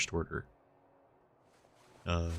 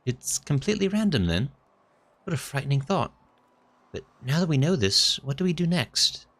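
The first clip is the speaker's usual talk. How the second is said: put-on higher, worried young companion; nervous companion; 7 semitones higher, 4 dB louder than usual. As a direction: fret about the danger. Second NPC, frightened.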